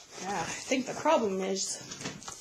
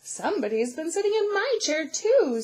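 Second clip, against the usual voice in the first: higher, loud, indignant mama bear; 6 semitones higher, 4 dB louder than usual. A narrator's reading. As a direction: complain as the middle-sized mama bear. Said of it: "said middle-sized Mama Bear".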